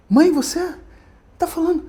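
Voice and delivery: flustered son, pitched high and hushing his mother; embarrassed son rushing his mother off the phone; a son caught out, hushed and a little panicky; nervous son on the phone. Retold or imitated. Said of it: "Já ficou nervoso".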